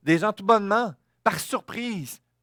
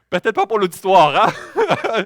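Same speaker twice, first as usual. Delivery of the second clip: laughing; 8 dB louder than usual. Real, not acted. Joke about dying mid-sermon.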